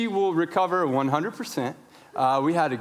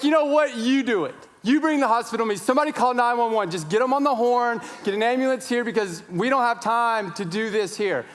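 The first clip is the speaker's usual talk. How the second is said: frantic shouted orders; 6 semitones higher than usual. Retold or imitated. Father needing an ambulance, frantic shouted orders. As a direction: take over and order everyone around.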